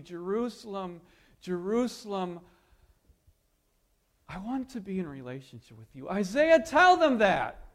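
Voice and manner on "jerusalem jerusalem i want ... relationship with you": much softer, lower tender plea; quick, soft, intimate plea to Jerusalem; romantic hush. Acted. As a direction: whisper it like a lover pleading. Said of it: "like a man speaks to a woman romantically".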